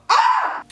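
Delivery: high squeal. Real, not acted. Squeal after a bite.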